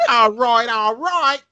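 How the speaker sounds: high, loud mock Cockney; hammed-up bad Cockney accent; chimney sweep's Cockney, pitched right up.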